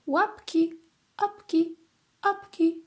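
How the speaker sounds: 점점 높아지는 메아리; rising, repeating echo. A narrator's reading. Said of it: "powtórzyło echo".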